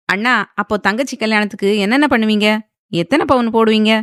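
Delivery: ordinary delivery, nothing marked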